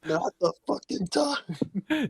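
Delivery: high, drawn-out exasperated whine; mock exasperation. Friend echoes complaint about visions mid-fight, high exasperated whine. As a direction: stretch each word in mock despair, pitched high. Echoing the exasperated in-game plea.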